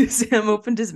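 reading through a laugh. Real, not acted. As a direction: giggle while reading the line. Rereading while laughing at friend.

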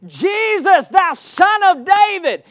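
loud, high shout; crying out. Acted. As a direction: cry out to Jesus at full voice. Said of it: "he begins to cry out".

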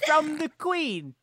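high, slow British scammer; mock British accent. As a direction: draw it out in fake British. British scammer bit continues.

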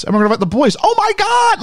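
imitated squeal rising very high; mock girlish excitement. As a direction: build to a very high-pitched thrilled squeal. Voicing girls excited about dance.

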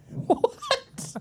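breaking up into high laughter; laughing. Real, not acted. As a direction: lose it laughing mid-line. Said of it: "[name] is unhinged today".

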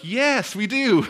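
high, loud crowd yell; crowd shout. Retold or imitated. Crowd yells back to the walker, high and loud.

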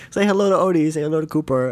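high cooing pet voice; mom talking to pets. Retold or imitated. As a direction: coo at the dogs. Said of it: "She's like".

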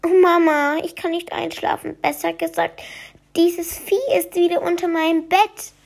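put-on high pleading little-boy voice; scared little boy. Maximilian begs his mother about the creature, high and pleading. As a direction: plead like a frightened child at night.

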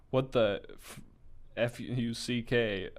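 usual speaking voice

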